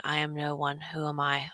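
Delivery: a low, soft murmur to herself; under her breath. Acted. Character murmuring to herself in shock, under her breath. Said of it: "under her breath".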